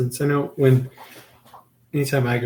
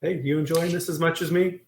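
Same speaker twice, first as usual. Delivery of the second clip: cheery greeting; 5 semitones higher, 3 dB quieter than usual. Retold or imitated. Imitating own trail greetings.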